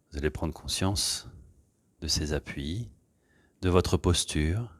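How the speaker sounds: gentle meditation voice leading breathing; guided meditation. Acted. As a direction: ease the class into stillness. Leads a guided relaxation.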